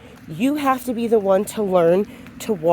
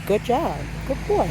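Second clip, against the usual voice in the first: calm praise; 4 semitones higher, 5 dB quieter than usual. Shown as a demonstration. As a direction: praise him calmly, without excitement. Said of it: "You can just say".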